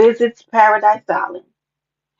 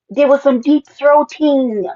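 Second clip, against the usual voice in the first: higher, louder and slow, savoring the scandal; slow mock-scandalized; 6 semitones higher, 4 dB louder, slower than usual. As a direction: drag it out like shocking tea. Repeats gossip for effect.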